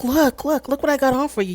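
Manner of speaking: high, excited showing-off; imitating eager attention-seeking. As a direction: mimic her begging him to look. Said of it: "trying to, uh, bring his attention".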